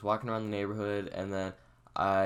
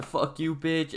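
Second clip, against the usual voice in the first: higher-pitched imitation of a friend cursing back; retold defiant retort; 8 semitones higher, 3 dB louder, slower than usual. Friend cursing at backyard lady, retold defiance. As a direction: toss the insult back, retelling it.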